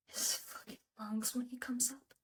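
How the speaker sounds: a voiceless, bitter whisper about the lungs. Acted. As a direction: hiss it out under the breath. Bitter confession about father's lungs.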